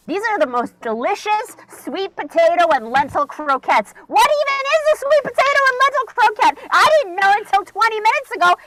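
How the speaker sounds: high, loud, fast gushing; raving about her croquettes, high and loud; ecstatic raving. Retold or imitated. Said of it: "I'm like".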